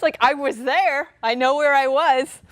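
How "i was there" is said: ordinary delivery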